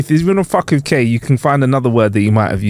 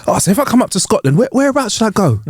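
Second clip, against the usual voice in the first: higher-pitched retold question; 8 semitones higher than usual. Caller asks the agent where to go, high-pitched. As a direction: ask it high and eager to know. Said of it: "I said to her towards the end".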